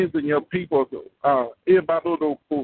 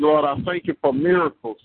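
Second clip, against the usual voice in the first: normal talking voice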